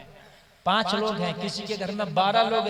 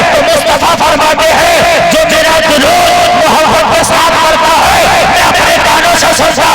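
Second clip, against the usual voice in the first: higher, louder, roaring sermon; shouted full-force preaching; 8 semitones higher, 18 dB louder than usual. Acted. Preacher roaring to the gathering about the grave, shouting. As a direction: shout it over the crowd.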